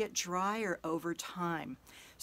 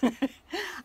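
embarrassed laugh; 9 semitones higher than usual. Real, not acted. Laughs at own stumble.

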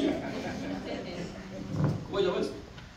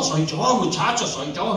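low-pitched imagined reply; deep, hammed-up announcing of oneself; mock ghostly reassurance; 7 semitones lower than usual. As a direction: drop the voice to play the figure.

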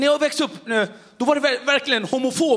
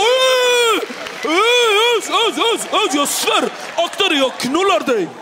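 furious tough-guy threat; 6 semitones higher, slower than usual. Retold or imitated. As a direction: blow up like a hothead bully. Zoran threatening the teller.

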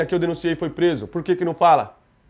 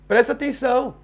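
ordinary delivery

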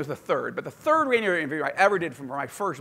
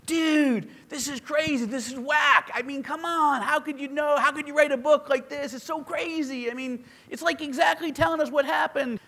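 surfer-dude radio host; 8 semitones higher than usual. Retold or imitated. Rock DJ marvelling at the author's book in a high dude voice. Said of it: "this guy literally talked this way".

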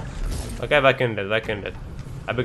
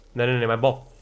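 ordinary delivery

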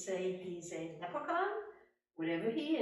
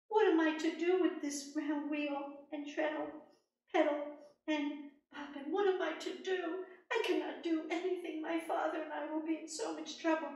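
put-on high, pleading young woman's voice; despairing young woman; 7 semitones higher, 4 dB louder than usual. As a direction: wail over the spinning wheel. Rose laments at the wheel.

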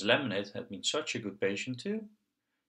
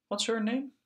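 put-on high child voice; 12 semitones higher, 3 dB louder than usual. Piggy voiced asking about doll.

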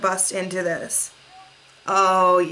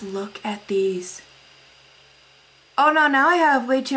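usual speaking voice

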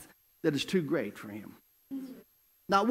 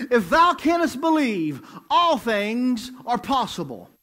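louder, higher, slow emphatic repeat; full preaching; 4 semitones higher, 5 dB louder, slower than usual. Acted. Preacher repeating the verse to the church, emphatic slow preaching. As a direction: drive the verse home like a revival preacher. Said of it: "listen to Jesus' response".

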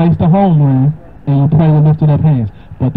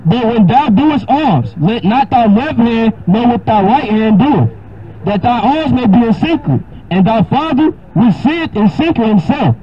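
raised, solemn King James reading; scripture reading; 6 semitones higher, slower than usual. Acted. Preacher reading Matthew six to a caller, solemn. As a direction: read it like holy writ.

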